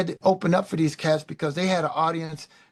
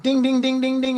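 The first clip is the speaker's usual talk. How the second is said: high, louder bell-like ding ding; mock bell ding; 9 semitones higher, 6 dB louder than usual. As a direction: ring it out like a light bulb idea. Idea going off in head.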